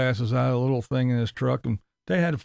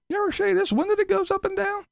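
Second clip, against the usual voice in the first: much higher, goofy rambling mock voice; mocking Tim; faster than usual. Mocking Tim rambling about coffee and his truck. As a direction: parody an endlessly repeating vlogger. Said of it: "That's what he does".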